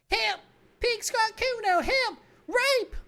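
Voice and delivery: put-on very high, loud screaming kid; child shouting for help. Child shouting for help at the windows, screaming. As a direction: scream to the whole block. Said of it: "a child shouts".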